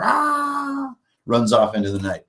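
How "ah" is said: very high frightened goblin yelp; a sudden squeal as it bolts; goblin shriek. Acted. Intimidated goblin runs off.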